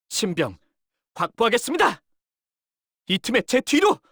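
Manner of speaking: high, loud battle order; commanding shout in combat; urgent command. Acted. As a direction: call the client behind you fast.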